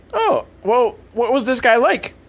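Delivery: put-on high, innocent teen; naive kid voice. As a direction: play Jimmy wide-eyed and high. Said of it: "And Jimmy's like".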